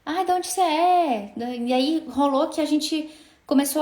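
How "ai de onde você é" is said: high, drawn-out curious question; delighted recognition. Retold or imitated. Client recognizing a shared accent asks where she's from, delighted. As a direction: imitate a client eagerly asking, stretching the words. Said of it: "Ela se identificou, ela falou".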